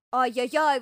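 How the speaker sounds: loud bear-cub holler; full-throated shout. Acted. Bear cub jumping off again, loud holler. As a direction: yell it out with force. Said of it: "во всё горло закричал медвежонок".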